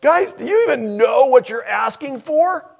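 loud, high-pitched incredulous question; incredulous rebuke. Retold or imitated. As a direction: call it out high and loud, acting out disbelief. Acted incredulous rebuke of disciples.

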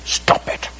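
put-on sharp whispered "stop it" to the child; mom's whispered order. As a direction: hush the kid with a stern whisper. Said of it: "Mom is saying to the kid".

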